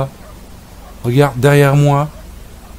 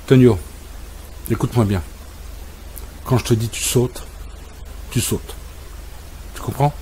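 low, soft, firm warning to the dog; a hint of a strict master, softer; stern low talk; 3 semitones lower, 9 dB quieter than usual. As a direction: lay down the law quietly.

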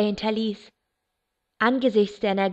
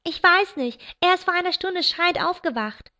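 Charity calling; 8 semitones higher than usual. A narrator's reading. Charity calls from the stairs while wrestling James, raised voice. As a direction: call it down the stairs, flustered. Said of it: "rief Charity".

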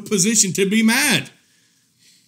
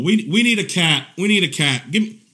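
ordinary talk, nothing marked

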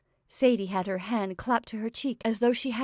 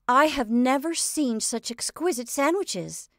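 rapturous; 6 semitones higher, 5 dB louder than usual. A narrator's reading. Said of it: "said Josie's rapturous voice".

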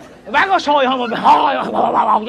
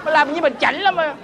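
high-pitched indignant rant; exasperated outburst; 6 semitones higher than usual. Acted. Buyer scolding snooty friend who refuses, exasperated high outburst. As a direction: burst out in high, stammering protest.

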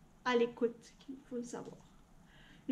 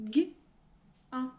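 robot voice. Shown as a demonstration. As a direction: separate sounds, spoken stiffly. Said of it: "Comment on va dire gant en robot?". (voix de robot, sons séparés)

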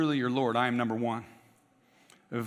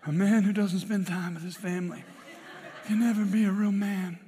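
higher-pitched, hammed-up Godfather accent; terrible Don Corleone accent; 4 semitones higher than usual. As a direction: do a bad Italian-American mob boss accent. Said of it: "That's a terrible accent".

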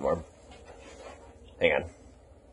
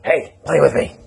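voicing the dog; 5 semitones higher, 4 dB louder than usual. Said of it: "he'll push it against you like".

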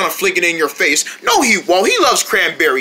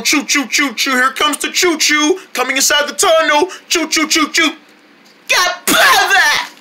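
put-on baby-feeding train noises; 6 semitones higher than usual. Shown as a demonstration. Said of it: "feed them the pro way".

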